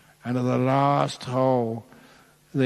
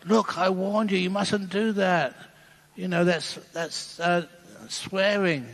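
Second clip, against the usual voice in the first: higher prim voice, wagging a finger at him; nun reprimanding the Pope for swearing, prim and higher; scolding nun; 6 semitones higher than usual. Retold or imitated.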